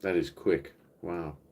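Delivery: usual speaking voice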